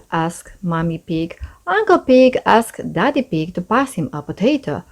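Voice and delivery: ordinary delivery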